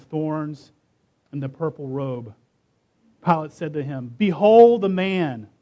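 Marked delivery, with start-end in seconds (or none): 4.2-5.5 loud, drawn-out proclamation; proclaiming. A narrator's reading. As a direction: present him to a crowd, slowly and loudly. Pilate presents Jesus to the crowd.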